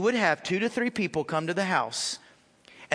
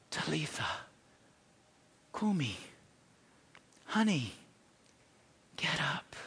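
whisper; 5 semitones lower, 9 dB quieter than usual. Retold or imitated. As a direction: breathe it gently to a sleeping child. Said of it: "and he whispers".

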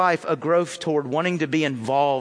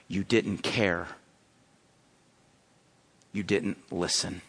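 much lower, softer, slow solemn verdict; grave Jesus; 9 semitones lower, 6 dB quieter, slower than usual. Acted. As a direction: let each word fall quietly and heavily. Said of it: "Jesus might respond to you and say".

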